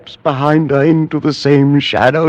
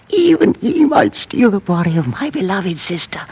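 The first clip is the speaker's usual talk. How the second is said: normal talking voice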